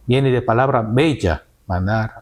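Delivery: ordinary delivery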